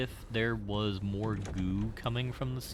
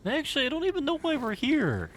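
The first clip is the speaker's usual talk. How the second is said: high loud outburst; 7 dB louder, faster than usual. Acted.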